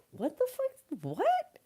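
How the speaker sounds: playing baffled sixth graders; mock shocked kids. Sixth graders baffled at her trivia, high mock disbelief. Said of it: "kids would be like".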